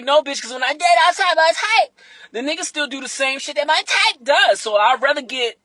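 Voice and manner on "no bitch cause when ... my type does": loud, high sassy comeback; sassy retort. Retold or imitated. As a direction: snap back at them, loud and high. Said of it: "And I'm like".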